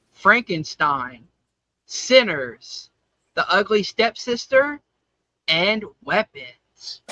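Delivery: slow, spaced-out nominee announcement; award announcer. Acted. Host reveals the best makeup nominees to listeners, ceremonial announcer. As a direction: read out the nominees with suspenseful pauses.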